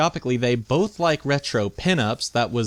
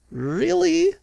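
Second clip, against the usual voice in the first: high incredulous squeak; shocked disbelief, pitched way up; surprised. Real, not acted. Incredulous at dating question.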